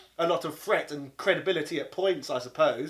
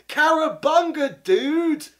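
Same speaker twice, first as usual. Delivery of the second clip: slow, high, loud Californian surfer cry; mock surfer dude accent; 8 semitones higher, 7 dB louder, much slower than usual. Mocking cartoon Turtles, loud surfer dude accent. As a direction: ham up the Turtles catchphrase. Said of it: "talk in this really annoying accent".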